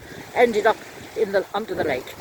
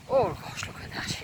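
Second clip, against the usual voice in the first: usual speaking voice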